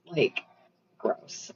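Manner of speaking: whispered "gross" with no voice at all; "gross" repeated as a disgusted whisper. Real, not acted. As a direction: drop the second "gross" to a bare breathy whisper. Disgust repeated under breath.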